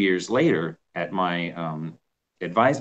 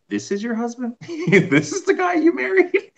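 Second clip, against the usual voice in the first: mock disbelief. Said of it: "I remember him saying".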